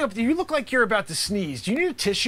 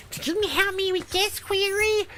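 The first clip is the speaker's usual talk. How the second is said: higher nerdy pleading voice; mock a needy student asking; whiny student voice; 11 semitones higher than usual. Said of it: "they're asking Mister McLavin Riven".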